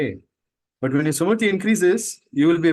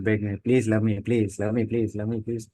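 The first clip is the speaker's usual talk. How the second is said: mock pleading beggar; 6 semitones lower, 5 dB quieter than usual. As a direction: lower and softer, whiny mock begging. Mocking beggar for love.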